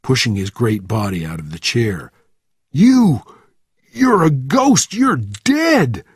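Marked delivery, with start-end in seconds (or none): 2.7-6.0 high, loud panic; shocked, horrified yelling, much higher; terrified shouting. A narrator's reading. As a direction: cry out as if seeing a ghost. Terrified sergeant sees a dead man.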